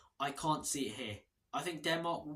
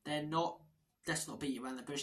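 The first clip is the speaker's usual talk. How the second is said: normal talking voice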